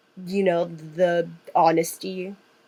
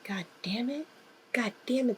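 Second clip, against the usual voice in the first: hushed, slightly higher amazement; retold awe; 5 semitones higher, 7 dB quieter than usual. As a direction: recall being quietly floored, under your breath. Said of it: "I was just like".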